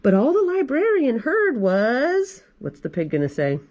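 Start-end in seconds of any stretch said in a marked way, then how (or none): none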